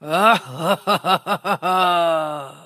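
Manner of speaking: loud, booming monster laugh; monstrous laugh. A narrator's reading. The forest spirit looms over Dimitri, laughing monstrously and loud. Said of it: "monsterlijk te lachen".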